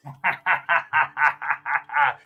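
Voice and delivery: long run of staccato ha-ha laughter. Pretend. Streamer laughing amid a mock raid, maniacal battle laugh. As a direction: laugh it out in a steady rattle.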